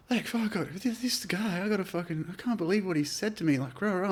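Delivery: high, soft imitation of a tearful girl; high, soft mock of the tearful English girl; upset girl. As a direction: pitch way up and talk fast like the girl in tears.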